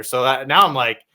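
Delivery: ordinary talk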